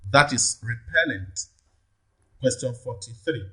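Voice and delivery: ordinary delivery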